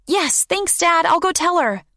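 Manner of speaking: put-on high excited child; a happy kid running off; young girl voice. Child voice in story.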